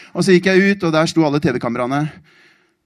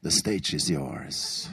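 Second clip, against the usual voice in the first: very soft, low and slow; hushed, expectant; 9 semitones lower, 10 dB quieter, much slower than usual. Retold or imitated. The silence telling him the stage is his, hushed. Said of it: "Stille på en sånn måte som sier".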